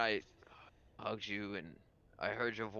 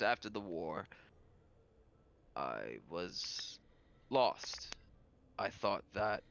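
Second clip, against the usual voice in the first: solemn lord character; 3 semitones lower, 4 dB quieter than usual. Acted. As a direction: weigh each phrase like a weary confession. Game master voices the lord.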